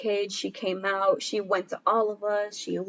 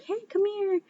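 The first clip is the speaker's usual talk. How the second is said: much higher soft call to a puppy; coaxing puppy; 12 semitones higher, 3 dB quieter than usual. Retold or imitated. Calling a shy puppy over, high and coaxing. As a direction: sweet-talk the puppy over.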